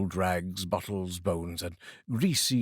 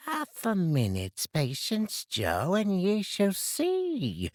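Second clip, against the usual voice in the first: put-on higher, brash old woman; a coarse London charwoman, pleased with herself; 11 semitones higher, slower than usual. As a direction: cackle it out like a cheeky charwoman. Charwoman voiced in story.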